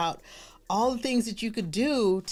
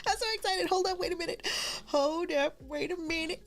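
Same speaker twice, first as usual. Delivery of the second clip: high, giddy squeal to the viewers; excited greeting; 11 semitones higher, 4 dB quieter, faster than usual. Real, not acted. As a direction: greet them excitedly, pitched way up. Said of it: "I'm so excited you're here".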